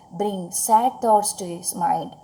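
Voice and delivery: ordinary talk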